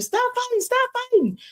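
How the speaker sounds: high screamed plea to stop; screaming. Retold or imitated. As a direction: scream at the fighting girls from the car. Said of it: "And I'm screaming".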